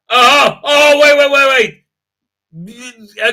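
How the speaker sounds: high, loud excited burst. Real, not acted. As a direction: stop everything, you just found gold. Spots something exciting online.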